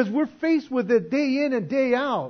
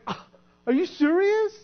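shocked disbelief; 10 semitones higher than usual. Retold or imitated. Shock at judgment seat.

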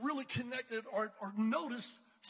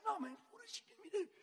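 eager question; 10 semitones higher, much faster than usual. Retold or imitated. Eager to serve the pastor.

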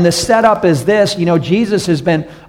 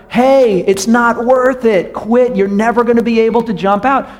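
higher, yelled down into a ditch; mock yell from above; 6 semitones higher than usual. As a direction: frogs jeering down at a stuck frog. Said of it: "kept yelling louder and louder".

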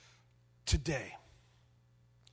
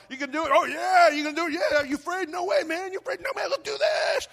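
higher, louder mock bravado between buddies; hyped-up guys; 9 semitones higher, 6 dB louder than usual. Pastor replaying friends daring each other on the ropes, mock bravado. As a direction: pump each other up, loud and cocky. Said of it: "hanging out with the guys".

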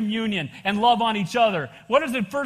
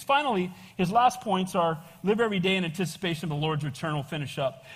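usual speaking voice

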